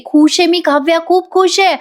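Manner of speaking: high, happy exclamation; acted delight. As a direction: gush like an overjoyed sister hugging. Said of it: "मिठी मारून म्हणते".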